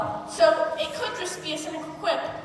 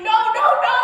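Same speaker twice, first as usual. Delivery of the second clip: louder and higher, a panicked no-no-no; inner scream; 7 semitones higher, 11 dB louder than usual. Retold or imitated. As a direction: let the voice in your head shout it. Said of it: "the voice in your head that's screaming".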